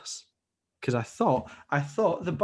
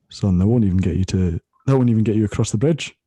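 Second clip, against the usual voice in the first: lightly voiced bus driver; 10 dB louder than usual.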